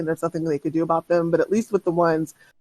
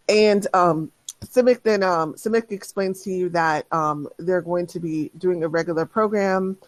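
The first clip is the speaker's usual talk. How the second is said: usual speaking voice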